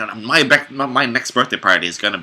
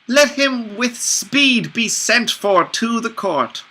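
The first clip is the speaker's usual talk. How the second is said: high character voice; 13 semitones higher, slower than usual. Acted. Character line in a play.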